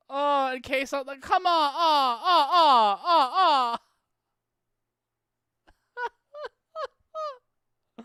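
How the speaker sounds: high, loud laughing wails of oh; cracking up so hard it turns to a cry; laughing helplessly. Real, not acted. Overcome reaction watching the replay.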